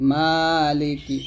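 মালিকি with long maa, short li and ki; stretched maa. Shown as a demonstration. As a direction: draw out only the first vowel of মালিকি. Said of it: "মাতে টান দিবেন".

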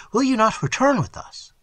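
high-pitched, pleading question; anxious plea. A narrator's reading. Glyndon asking his preserver to come along, anxious plea. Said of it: "said Glyndon anxiously".

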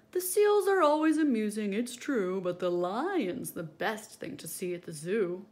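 loud, bright visitor voice; zoo visitor. Acted. Visitor behind the fence praising the lion, loud. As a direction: call it out like a passing visitor.

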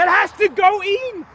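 high, loud yell at the ball; will the ball into the hole; urgent yell. Real, not acted. Golfer yelling at his putt to drop in the hole.

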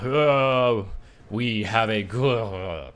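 slow words dissolving into a groan; dying gasped words. Acted. Figure beside the player falling down, slow dying groan. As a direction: choke out the line as you collapse. Figure collapses mid-sentence.

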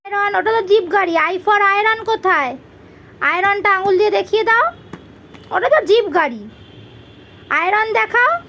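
ordinary talk

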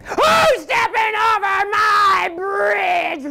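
slow, high-pitched roaring shout; troll's roar. Acted. Troll challenging the goat on his bridge, roaring. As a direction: roar it out, stretching every word. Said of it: "roared the troll".

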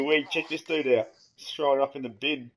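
usual speaking voice

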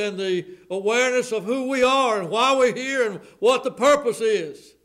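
high, loud, ringing preacher's cry; a revival preacher at full throttle; full-force preaching. Acted. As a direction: preach it out to the whole church.

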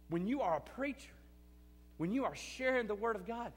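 normal talking voice

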